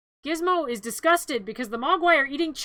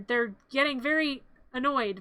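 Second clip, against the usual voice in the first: ordinary delivery, nothing marked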